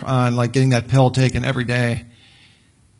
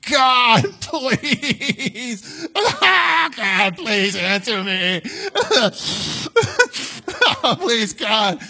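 high, wailing mock plea to God, broken by laughs; mock desperate begging; 9 semitones higher, much slower than usual. Preacher mocking a frantic churchgoer, whining high and pleading. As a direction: ham up a frantic, tearful prayer. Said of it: "we don't need to sit there and, like".

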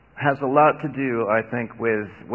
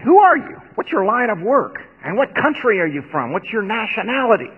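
sharp, much higher, louder questions; angry demand; 8 semitones higher, 4 dB louder than usual. A narrator's reading. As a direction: interrogate him fast and hard. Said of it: "they demanded".

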